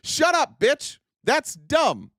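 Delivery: high mocking yell at a troll. Shown as a demonstration. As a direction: shout it down like a bully.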